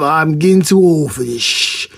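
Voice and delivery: slow, drawn-out movie cop catchphrase; put-on voice of an over-it veteran cop; Lieutenant Murtaugh. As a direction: imitate a world-weary cop. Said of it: "Lieutenant Murtaugh".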